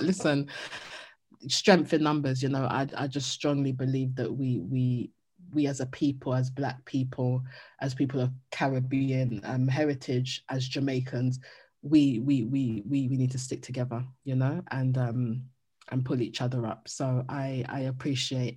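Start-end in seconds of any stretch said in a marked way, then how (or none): none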